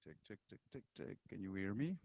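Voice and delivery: faint, distant mic signal; barely audible through a weak mic; muffled low mic. Real, not acted. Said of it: "your volume was little low".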